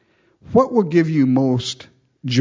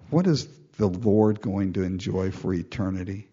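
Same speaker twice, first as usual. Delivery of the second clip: usual speaking voice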